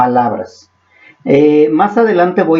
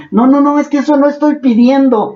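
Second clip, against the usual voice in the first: mock protesting believer; 9 semitones higher than usual. Believer objecting to the Spirit's prayer.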